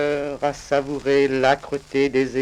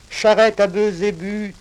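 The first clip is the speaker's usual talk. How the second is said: normal talking voice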